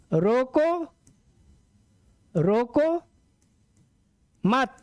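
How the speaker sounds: "रोको मत" word by word, with long pauses; pause at the comma. Shown as a demonstration. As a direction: dictate "रोको", hold, then set "मत" apart before the comma. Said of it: "कामा है".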